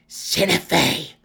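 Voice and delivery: loud, high shocked cry; startled cry. A narrator's reading. Malice cries out seeing her rival seated. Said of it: "she cried".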